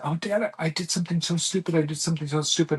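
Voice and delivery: rushed mock distress; retold panic. Son confessing to dad, fast imitated panic. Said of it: "He just has all this panic".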